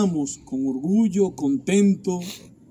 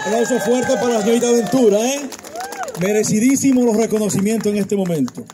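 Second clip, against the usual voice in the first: announcer hype; 4 semitones higher, 4 dB louder, faster than usual. Real, not acted. MC calls for applause.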